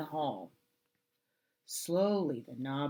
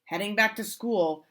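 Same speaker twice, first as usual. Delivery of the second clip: ordinary delivery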